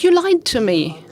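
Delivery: outraged at being tricked; accusing. Acted. King to his fled parrot, hurt and accusing.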